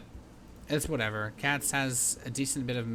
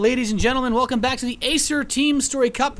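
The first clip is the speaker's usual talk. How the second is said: loud, high arena announcer voice; hype announcer; 10 semitones higher, 7 dB louder than usual. Acted. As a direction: pump up the crowd like a ring announcer. Caster opening the broadcast.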